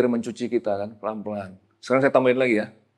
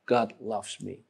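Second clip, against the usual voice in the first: quiet inner conviction; 3 semitones lower, 11 dB quieter, much slower than usual. Acted. John's quiet inner knowing.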